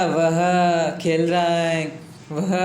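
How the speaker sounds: slow and deliberate, word by word; slow demo reading. Shown as a demonstration. Teacher slowly spelling out the translation to students, deliberate. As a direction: lay out each Hindi word slowly for the class. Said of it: "अब ये ऐसे बनेगा".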